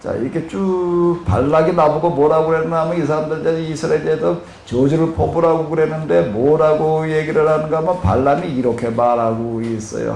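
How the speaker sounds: ordinary talk, nothing marked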